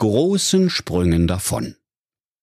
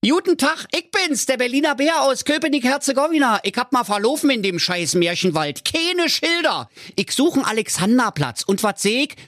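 very high, cartoonish bear with a Berlin twang; a lost, grumbling Berlin bear, voiced high and comic; squeaky bear talking Berlin slang. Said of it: "und sagte".

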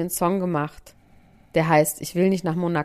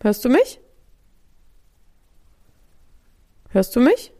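raised voice, asking if heard; calling out; 4 semitones higher, 3 dB louder than usual. Real, not acted. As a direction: call out over a bad line. Connection dropped, calling to Max.

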